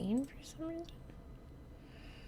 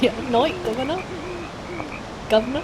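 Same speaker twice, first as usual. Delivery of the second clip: higher, louder imitation London accent; mock London accent; 6 semitones higher, 5 dB louder, faster than usual. Echoing the game's English character, mock London accent. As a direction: put on a playful London cabbie accent. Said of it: "that London, that accent".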